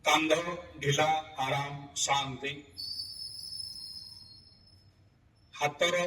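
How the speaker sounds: spaced-out, soothing phrases that sink away at the end; guided relaxation. Real, not acted. Yoga teacher relaxing students from belly to neck, guided meditation. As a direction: let each word settle before the next, easing down. Leads body-part relaxation.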